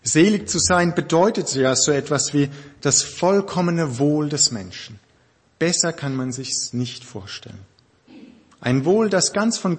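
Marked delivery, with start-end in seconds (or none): none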